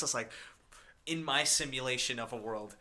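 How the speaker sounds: normal talking voice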